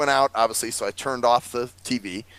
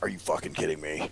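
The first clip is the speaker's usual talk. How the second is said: low, soft exasperated mutter; a hint of retold anger; muttered disbelief; 10 semitones lower, 10 dB quieter than usual. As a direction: grumble it under your breath. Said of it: "And now I'm like".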